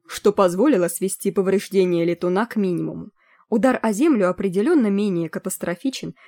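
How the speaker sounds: usual speaking voice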